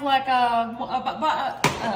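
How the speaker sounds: stuck on a word, stammering; tongue-tied stammer. Real, not acted. Cook losing the word for an ingredient, tongue-tied stammer. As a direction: trip over the word and stall. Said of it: "I can't even talk".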